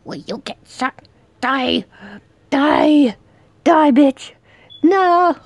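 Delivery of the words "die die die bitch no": loud, higher battle yells; real heated fight shouting; furious shouting. Real, not acted. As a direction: scream at the enemy. Fighting the killer.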